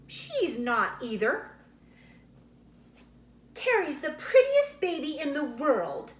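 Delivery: insistent, rising to a loud high protest; indignant child. A narrator's reading. Laura insisting to her cousin, childish indignation. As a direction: stand up for baby Carrie. Two girls arguing over babies.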